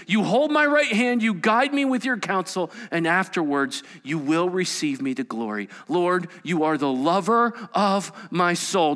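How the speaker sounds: loud, high, fervent psalm reading; preaching at full force. Acted. Preacher proclaiming Psalm seventy-three's promise to the congregation, fervent and loud. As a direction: pour out the psalm as a cry of devotion.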